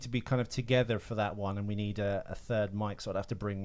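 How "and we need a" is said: ordinary talk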